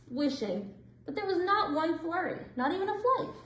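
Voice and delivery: ordinary delivery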